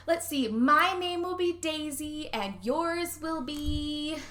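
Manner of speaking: put-on high, slow little girl voice. Retelling the movie to a friend, a slow high little-girl voice. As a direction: lilt it like a small girl naming dolls.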